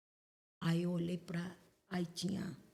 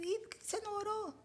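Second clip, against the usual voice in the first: reproachful question; 10 semitones higher, 4 dB quieter than usual. Retold or imitated. Questioning the sister who called.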